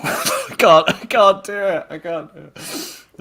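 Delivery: high, helpless giggling; laughing. Real, not acted. Failing the requested villain voice, helpless laughter. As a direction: give up through laughter. Breaks up during attempted impression.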